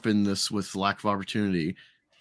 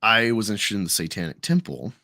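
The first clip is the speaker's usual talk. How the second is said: normal talking voice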